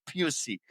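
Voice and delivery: PUSSY said with a "pyoo" first syllable; "pussy" pronounced like the start of pusillanimous; odd pronunciation. Shown as a demonstration. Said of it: "That's why I say it so weird".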